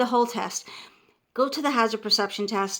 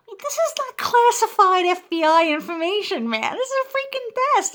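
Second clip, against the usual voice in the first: high-pitched, louder mock protest; exasperated outburst; 10 semitones higher, 5 dB louder than usual. Test-taker fed up with being searched, exasperated. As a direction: send the voice way up in disbelief.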